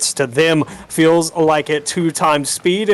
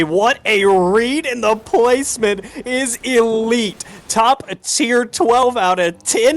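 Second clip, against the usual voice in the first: excited praise; 7 semitones higher than usual. Real, not acted. Co-caster praises the goal.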